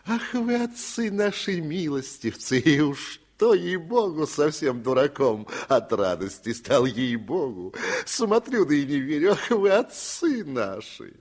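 high, loud tipsy sing-song; sing-song even harder. A narrator's reading. Drunken bailiff croons praise to his master. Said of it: "запел пуще прежнего".

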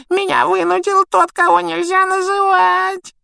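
very high, loud, wailing excuses; Pettigrew sobbing. A narrator's reading. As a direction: whine and plead in a squeaky tearful voice. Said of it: "Петтигрю зарыдал". (тонкие плаксивые причитания)

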